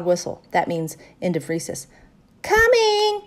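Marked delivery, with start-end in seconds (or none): 2.4-3.2 high, drawn-out call; shout. A narrator's reading. First friend answers the recess whistle, shouting. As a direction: call out from across the playground. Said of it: "shouted Yellowy".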